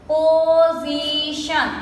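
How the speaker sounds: "Position" chanted slowly as po-zi-shun; slowly broken up. Shown as a demonstration. Teacher working through textbook words, "Position" slowly syllable by syllable. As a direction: stretch each syllable of "Position". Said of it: "we say it slowly and we break the word".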